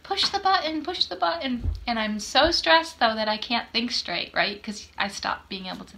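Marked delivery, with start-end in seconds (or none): 0.0-0.8 higher, pushy call from the crowd; impatient call. Retold or imitated. Strangers behind her on the train urge her, calling higher. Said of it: "people behind me saying". 0.8-1.7 the repeat, still higher and insistent; repeated impatient call. Retold or imitated. The crowd repeats their call to the stuck woman, pushy. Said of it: "people behind me saying".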